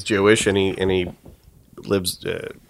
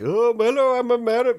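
high mocking voice; 13 semitones higher than usual. Mocking the pranked guy.

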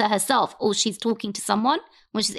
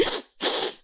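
imitated tearful gasps for air; acted sobbing intake of breath; mock sobbing gasp. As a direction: gasp as if choking back sobs. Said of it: "I was sitting there like".

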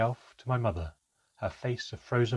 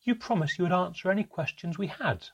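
frustrated outcry; 8 semitones higher than usual. A narrator's reading. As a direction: cry it out in frustration. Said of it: "I cried out in frustration".

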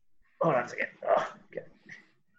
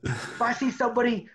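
high, loud coach yelling at players; barking gym coach; 8 semitones higher, 4 dB louder than usual. Retold or imitated. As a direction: bark it like a gym coach on the field. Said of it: "like, the coach blew the whistle".

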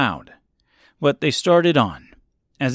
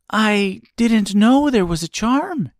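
put-on high girl voice, surprised; 10 semitones higher than usual. A narrator's reading. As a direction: answer in surprise as a young girl. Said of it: "answered Dorothy surprisingly".

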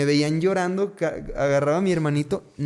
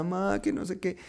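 mild mock whine, higher and softer; enojado con mamá; 5 semitones higher, 6 dB quieter than usual. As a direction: lightly imitate a cross little boy. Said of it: "yo enojado con mi mamá". (reproche infantil, leve)

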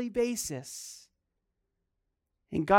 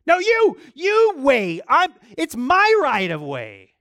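loud, much higher protest, slowing at the end; indignant shout; 8 semitones higher, 9 dB louder, slower than usual. Pretend. Driver shouting at another driver over right of way. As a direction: insist on your right of way, outraged. Acted angry driver insisting.

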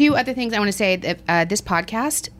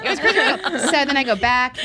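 ordinary delivery

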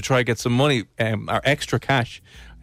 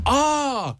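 exasperated groan; 12 semitones higher than usual. Retold or imitated. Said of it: "And you're like".

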